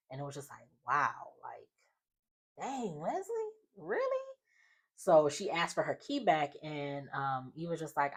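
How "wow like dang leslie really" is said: mock disbelief. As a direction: voice climbing to a very high really. Retold stunned reaction to Leslie.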